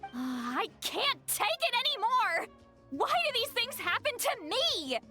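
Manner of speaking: high-pitched, dramatic despair; distraught wail. Acted. Mortified woman whose private thoughts were overheard, wailing. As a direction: wail it out in total exasperation.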